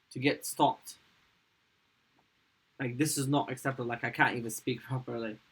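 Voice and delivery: usual speaking voice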